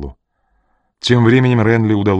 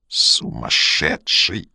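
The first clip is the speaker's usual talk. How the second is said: slow, choked hiss of rage; strangled fury; 4 semitones lower, much slower than usual. A narrator's reading. Жанна breaks the silence, strangled and furious. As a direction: squeeze it out furiously. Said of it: "голос её прозвучал одновременно сдавленно и яростно".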